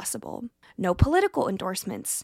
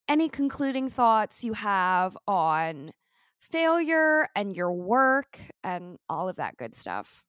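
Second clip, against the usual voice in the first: normal talking voice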